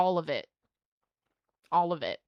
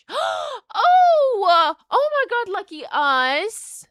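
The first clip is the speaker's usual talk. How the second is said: very high, loud shriek of joy; excited shout; 12 semitones higher, 9 dB louder, much slower than usual. Real, not acted. Pulling a holo card, loud high shriek. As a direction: shriek it out thrilled.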